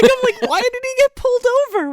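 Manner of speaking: very high, loud incredulous question; laughing disbelief. Real, not acted. Co-host baffled by the film's plot, high loud disbelief. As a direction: squeal it out in baffled amusement.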